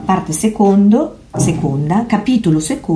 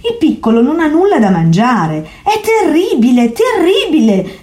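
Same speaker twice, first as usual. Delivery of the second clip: insistent alarm; 9 semitones higher than usual. A narrator's reading. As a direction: plead the alarm, rising on terribile. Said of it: "insisté Diderot".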